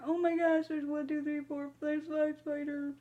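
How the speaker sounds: high, squealing dismay at each spider; creeped out. Real, not acted. Counting spiders on the chart to viewers, high creeped-out squeal. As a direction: count them like they're crawling on you.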